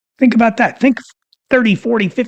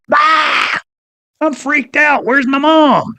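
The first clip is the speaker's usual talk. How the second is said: panicked newborn; 9 semitones higher, slower than usual. Acted. Newborn baby voiced, panicking.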